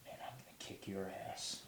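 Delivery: patient threatens the doctor in a whisper. Real, not acted. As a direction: hiss it under your breath. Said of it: "threat muttered under breath".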